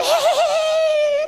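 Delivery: very high drawn-out wheezy squeal; squealing laugh imitation. Shown as a demonstration. Preacher shows how some people laugh, piercing squeal. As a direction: do a ridiculous high-pitched laugh. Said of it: "some people".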